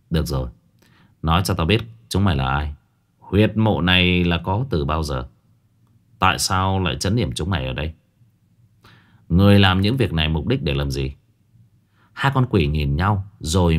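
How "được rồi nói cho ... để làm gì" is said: low voice. Acted. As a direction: interrogate in a low stern voice. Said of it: "mà trầm giọng". (trầm giọng tra hỏi)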